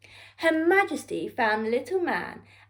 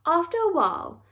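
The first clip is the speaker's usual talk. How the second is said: plain reading voice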